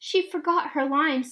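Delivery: plain reading voice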